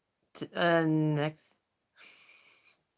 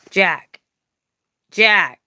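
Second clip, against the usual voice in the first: calling out sharply; 2 semitones higher, 9 dB louder than usual. Real, not acted. Calling the pet by name.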